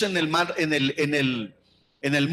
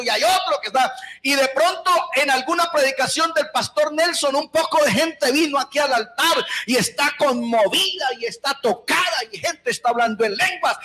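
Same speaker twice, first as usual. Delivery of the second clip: fiery preaching; 7 semitones higher than usual. Real, not acted. A preacher scolds his distracted church with mounting fire. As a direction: build the indignation line by line. Preacher builds to full force.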